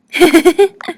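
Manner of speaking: high, loud burst of chuckling; chuckle. A narrator's reading. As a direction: laugh heartily in quick bursts. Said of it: "He laughed".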